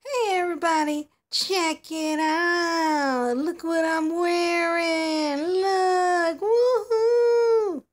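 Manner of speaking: loud, slow, squealing show-off. Acted. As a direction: squeal with delight showing off the outfit. Doll showing off her outfit.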